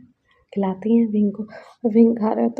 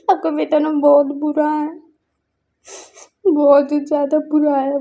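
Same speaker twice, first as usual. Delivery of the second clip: higher, louder tearful whine; crying complaint; 5 semitones higher, 5 dB louder than usual. Acted. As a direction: complain to mother through tears. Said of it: "बोल के वो रो रहा था". (रोते हुए शिकायत)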